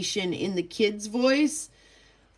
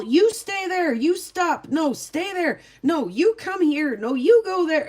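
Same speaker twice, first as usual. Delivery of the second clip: put-on high, louder commands to the kids; a mom herding her kids, barking orders; bossy mom; 8 semitones higher, 4 dB louder than usual. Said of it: "trying to wrangle them all".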